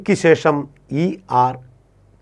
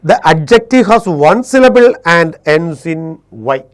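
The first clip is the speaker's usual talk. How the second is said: very slow, word by word, loud and clear; slow dictation; 2 semitones higher, 5 dB louder, much slower than usual. Shown as a demonstration. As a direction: dictate the rule so students can write it. Teacher dictates the English rule. (പതുക്കെ പറഞ്ഞുകൊടുക്കുന്ന നിയമം)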